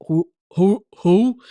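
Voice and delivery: loud, put-on gruff phone voice; disguised tough voice. Screening unknown callers on the phone, loud disguised tough voice. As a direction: bark it out like a tough guy screening calls. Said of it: "answer it with a disguised voice".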